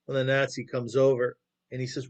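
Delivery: ordinary delivery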